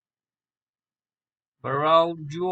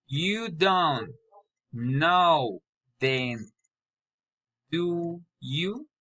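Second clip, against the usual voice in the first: rising tune on "do you"; statement falls, then lift "do you" at the end; tag question intonation; slower than usual. Shown as a demonstration. Learner practising tag questions, rising on "do you". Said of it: "poly or raising intonation".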